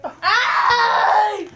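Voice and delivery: laughing yelp. Real, not acted. Reacting to taste.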